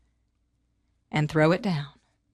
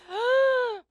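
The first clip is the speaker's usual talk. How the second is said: gasp; 13 semitones higher than usual. Acted. Shocked reaction.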